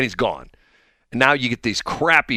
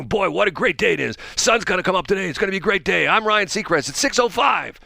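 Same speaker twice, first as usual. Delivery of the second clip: chirpy host, higher, mock cheer; Ryan Seacrest impression; 5 semitones higher than usual. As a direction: pump out cheesy time checks with fake pep. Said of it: "go into a studio and go".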